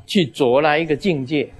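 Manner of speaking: normal talking voice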